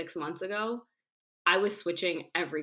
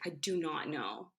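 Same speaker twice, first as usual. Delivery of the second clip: slow, soft, choked-up admission; emotional; 6 dB quieter, slower than usual. Retold or imitated. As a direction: let it out, drained and emotional. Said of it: "I was so emotional too".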